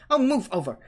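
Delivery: loud high impatient snap; impatient girl snapping at the boys; snarl. A narrator's reading. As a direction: shove them aside with your voice. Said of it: "Hermione snarled".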